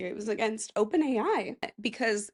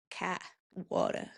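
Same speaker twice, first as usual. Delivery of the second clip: Boston accent; 3 semitones lower, 9 dB quieter than usual. Shown as a demonstration. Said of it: "I can do one pretty good".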